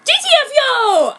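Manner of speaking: very high, loud rage yell. A narrator's reading. Heroine yells at Aoyama at her window, rage. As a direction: scream it out in rage. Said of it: "I yelled with rage".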